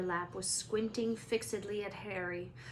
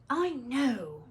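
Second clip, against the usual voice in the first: snapped; 4 semitones higher, 5 dB louder than usual. A narrator's reading. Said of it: "Ron snapped".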